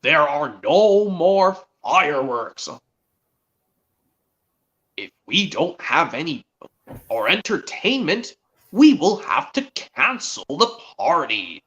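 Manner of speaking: slow, cross boss pronouncement; Sir Tom Hat. Acted. Railway boss telling engines the party may be cancelled, slow and cross. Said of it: "Sir Tom Hat was still cross at Thomas".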